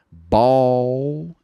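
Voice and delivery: Ball said slowly with an aw vowel. Shown as a demonstration. Teacher contrasting ball with bow. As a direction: draw out the aw. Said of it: "Olha a diferença aqui".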